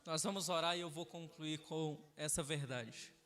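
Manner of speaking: low, soft, subdued pulpit voice; hushed, measured closing words; solemn sermon closing. Acted. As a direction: bring the congregation down quietly toward prayer. Solemn closing before prayer.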